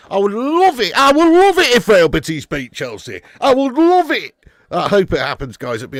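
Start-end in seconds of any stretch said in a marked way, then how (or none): 0.1-4.3 loud, high Yorkshire-style rant with a punched LOVE; Kevin Keegan impression. Football streamer wishing for a Betis win, impassioned mock northern rant. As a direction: passionate football manager outburst in a bad northern accent. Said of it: "shite version of Kevin Keegan's accent".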